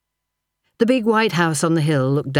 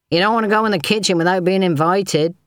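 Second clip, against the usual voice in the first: called after; 5 semitones higher than usual. A narrator's reading. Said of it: "he called after her".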